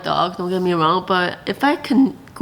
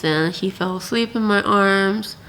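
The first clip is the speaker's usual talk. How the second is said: usual speaking voice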